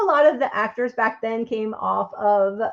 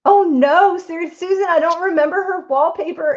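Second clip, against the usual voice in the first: dismayed; 6 semitones higher, 4 dB louder than usual. Real, not acted. Dismayed at forgetting wallpaper.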